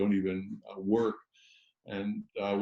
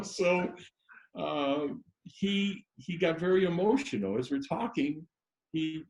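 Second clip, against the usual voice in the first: normal talking voice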